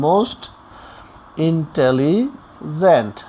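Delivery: Intelligent very slowly, pausing before gent; broken into syllables. Shown as a demonstration. Teacher showing syllables to learners, most intelligent broken up slowly. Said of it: "ভেঙে ভেঙে উচ্চারণ করা যায়".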